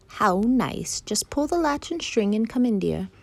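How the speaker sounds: high, lilting granny voice; grandmother. Acted. Grandmother lets her visitor in, high lilting voice. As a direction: invite them in like an old granny.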